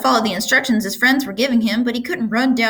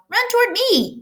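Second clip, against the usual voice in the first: yelling gander. A narrator's reading. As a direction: shout for him to come. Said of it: "yelled the gander".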